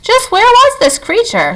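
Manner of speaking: high sly fox voice. A narrator's reading. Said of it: "said Fink the fox".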